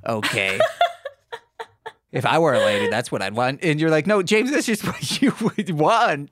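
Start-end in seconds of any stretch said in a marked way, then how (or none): 4.1-6.3 laughing retort. Retold or imitated. Voicing friend's retort.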